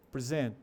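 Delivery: "present" as pre-ZENT, weight on the end; verb stress. Shown as a demonstration. Teacher contrasts verb with gift, "present" stressed at the end. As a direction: stress the last syllable of "present". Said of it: "que seria apresentar".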